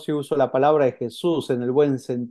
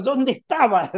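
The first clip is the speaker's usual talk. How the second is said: scolding parent; 8 semitones higher than usual. Retold or imitated. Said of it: "lo sacudimos".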